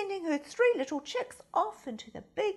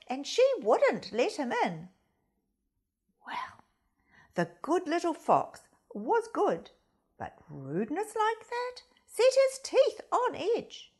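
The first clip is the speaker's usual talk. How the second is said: plain reading voice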